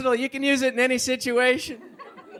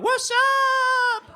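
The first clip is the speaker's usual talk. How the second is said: very high drawn-out whassup call; Budweiser whassup. Retold or imitated. People repeating the beer ad, high drawn whassup. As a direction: mimic the beer ad shout. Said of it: "I hear people all the time going".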